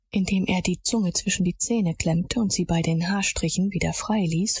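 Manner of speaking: usual speaking voice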